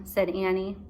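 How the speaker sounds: usual speaking voice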